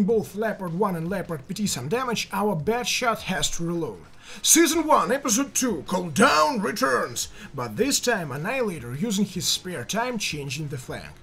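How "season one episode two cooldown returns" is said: trailer announcer. Acted. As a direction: announce it like a TV season premiere. Announcing like a TV series.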